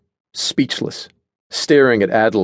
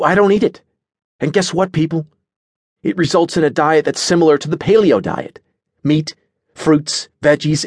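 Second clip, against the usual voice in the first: higher-pitched, animated character voice; emphatic Edric; 8 semitones higher than usual. A narrator's reading. Edric explains his food philosophy, emphatically. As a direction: hold forth in keen agreement.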